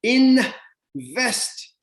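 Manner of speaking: Invest called out high and loud; a loud high exclaimed Invest; triumphant reveal. Shown as a demonstration. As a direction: announce Invest like a revelation. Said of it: "what's the word in?".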